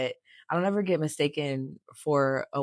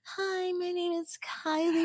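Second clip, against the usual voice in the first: put-on drawn-out, very high girly voice; slow, sky-high mock feminine introduction; Kylie; slower than usual. Joining in the joke on feminine voices, mock girly. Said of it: "talk like this".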